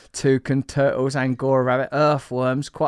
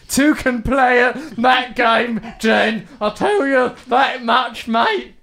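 mock swagger; 8 semitones higher, 6 dB louder, slower than usual. Mock bravado about toucan.

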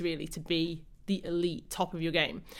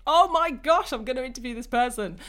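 excited; 6 semitones higher, 7 dB louder than usual. Retold or imitated. Excited reaction to guest inbox.

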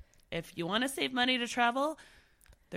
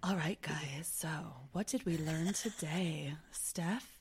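breathy sultry whisper; husky come-hither whisper; sexy voice; 4 semitones lower than usual. Pretend. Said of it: "with a little sexy, little sexy outro".

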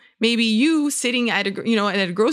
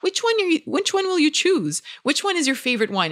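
put-on much higher, bright voice for a toddler; cheerful redirect; 8 semitones higher than usual. Parent at the store turning the child to next time, bright and high. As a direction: perk up to steer the child's attention.

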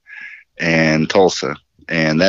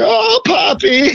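much higher, slow hammed-up moan; Todd's mock moan through the hotel hallway; slower than usual. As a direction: stretch it out in a high mock moan. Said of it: "Todd's like".

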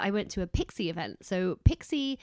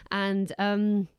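ordinary talk

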